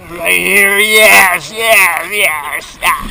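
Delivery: loud cheering yeses; excited shout. Real, not acted. Cheering on the raft.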